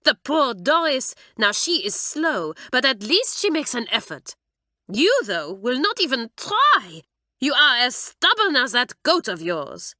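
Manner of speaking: a French teacher crying out at her pupil; exasperated French mistress. Acted. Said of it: "she would cry".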